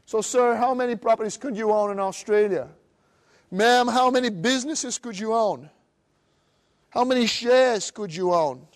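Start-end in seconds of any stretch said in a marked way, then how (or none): none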